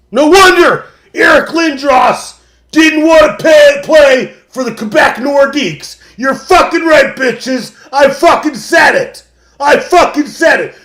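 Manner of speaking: high, loud furious tirade; ranting shout. Real, not acted. Host ranting about Quebec, high loud shouting. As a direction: yell it at the whole province. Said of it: "I need to calm down".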